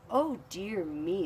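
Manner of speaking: drawn-out worried sigh of a line; oh dear me. Acted. Crayon character fretting over Red, lightly voiced.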